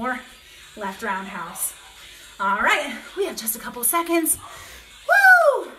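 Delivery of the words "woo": a high, loud whoop; cheering woo. Real, not acted. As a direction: let out a loud triumphant whoop. End of the round.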